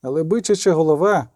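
usual speaking voice

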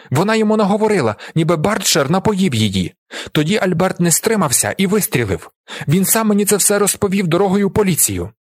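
high-pitched, heated testimony; shouted accusation. A narrator's reading. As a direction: pour out the accusation unstoppably. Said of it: "кричить він Альберту".

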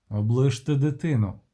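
ordinary delivery

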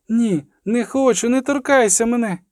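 put-on high child's plea; frightened protest. A narrator's reading. Girl begging the sorceress not to touch her, frightened. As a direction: fend them off in a high, panicked voice.

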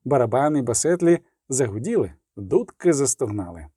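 ordinary delivery

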